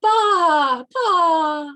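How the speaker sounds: loud, high bleats; lamb bleating. A narrator's reading. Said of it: "the lamb went".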